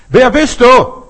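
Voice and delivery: high, loud call into the mountains; child calling out. Acted. Child asks the unseen caller, loud high shout. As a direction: shout the question far out. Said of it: "Frag du, wer du bist".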